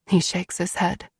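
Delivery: ordinary delivery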